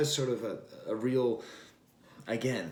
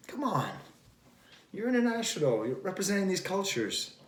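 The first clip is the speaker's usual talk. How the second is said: exasperated; 8 semitones higher than usual. Real, not acted. Exasperated plea to the artist.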